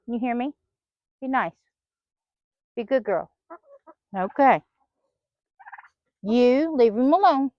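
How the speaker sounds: ordinary talk, nothing marked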